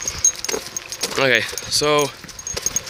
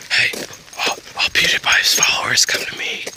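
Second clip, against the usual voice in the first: whispered plea to the fans; conspiratorial whisper, almost secret. Pretend.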